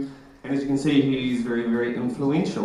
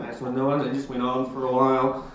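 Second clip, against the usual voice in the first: ordinary delivery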